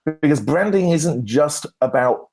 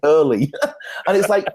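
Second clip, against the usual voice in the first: usual speaking voice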